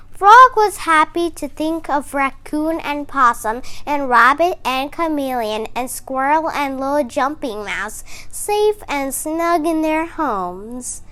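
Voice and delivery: usual speaking voice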